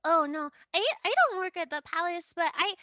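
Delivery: high, small filly voice; little voice. Acted. Tiny filly offering hors d'oeuvres to a guest, high and small. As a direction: play a tiny shy foal offering food.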